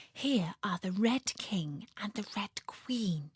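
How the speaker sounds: soft, breathy whisper. A narrator's reading. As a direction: hush it so you won't frighten them. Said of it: "in a whisper for fear of frightening them".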